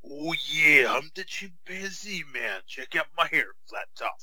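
high, drawn-out mock wrestler voice; jokey high-pitched wrestler impression; Macho Man. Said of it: "Look at Macho Man with the hat off".

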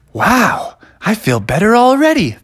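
high, swooping wonder; amazed relief. Acted. Cured raccoon to his rescuers, amazed relief.

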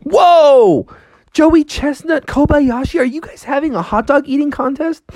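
high-pitched astonished cry; amazed Froggy. Acted. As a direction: call out in high-pitched wonder at the contest. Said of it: "Froggy's like".

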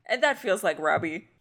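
ordinary talk, nothing marked

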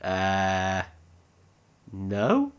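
low, hesitant stalling; drawn-out, unsure reply with pauses; thinking on his feet. Acted. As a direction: act caught out, improvising an excuse. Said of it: "thinking on his feet".

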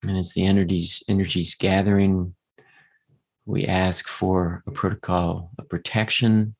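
guided meditation voice. Acted.

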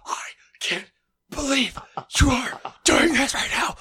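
mock furious whisper yell; hissed rage, pitched high. Shown as a demonstration. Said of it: "they start whisper yelling".

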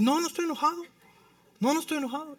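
high, defensive voice insisting he's not angry; mock defensive denial. Preacher mocking a grumpy person's high, defensive denial.

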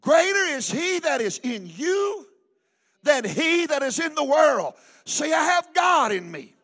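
ringing preacher's declaration; scripture proclamation. Real, not acted. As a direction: proclaim the verse to the congregation. Recites scripture at preaching force.

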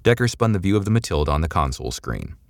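ordinary delivery

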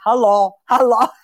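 normal talking voice

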